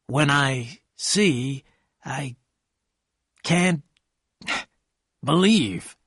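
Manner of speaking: very slow, halting, words spaced apart; slowly. A narrator's reading. Gatsby to Daisy and narrator, slow and halting. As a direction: let each word hang, dazed. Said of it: "Gatsby said slowly".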